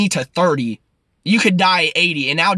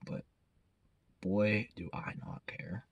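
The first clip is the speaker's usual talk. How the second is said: a slow, softer whisper with a shrug in it; slow whispered aside; 6 semitones lower, 17 dB quieter, slower than usual. Real, not acted. Admitting to viewers he doesn't care, slow whisper. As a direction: whisper it like a confession you enjoy.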